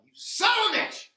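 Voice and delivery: high, loud mock outburst; angry shout. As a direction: blow up in mock rage. Mock outburst at losing his train of thought.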